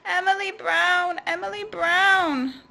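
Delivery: high, loud grumbling creature voice. Acted. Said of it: "grumbled the thing".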